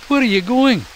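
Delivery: a small critter calling out after his friend; raccoon Ian. Acted. Ian calling after Mac, high raccoon voice.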